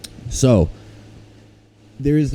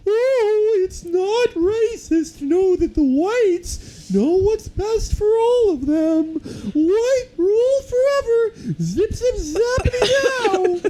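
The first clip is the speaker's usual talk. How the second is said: very high, slow squeaky cartoon voice; vintage cartoon voice; slower than usual. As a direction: squeal it like an old cartoon character. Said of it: "I just read it in that cartoonish voice".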